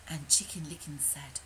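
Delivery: ordinary delivery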